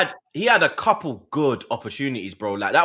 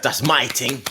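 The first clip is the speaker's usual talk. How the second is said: lower, mocking echo of a proud boast; mock with chest; 5 semitones lower than usual. Teasing his friend, echoing the boast with chest. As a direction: parrot his line back with fake swagger. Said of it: "He said that with chest".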